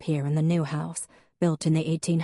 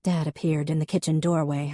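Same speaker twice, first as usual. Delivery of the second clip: ordinary delivery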